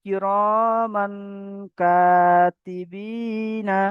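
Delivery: very slow chanted কিরমান কাতিবিনা, each syllable drawn out; slow Quran recitation. Shown as a demonstration. Teacher leading students in slow recitation. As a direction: recite it slowly for the class to repeat. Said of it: "তাহলে কি হবে?".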